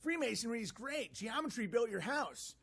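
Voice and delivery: high mock-proud Marty voice. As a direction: parody him bragging. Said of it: "And they're like".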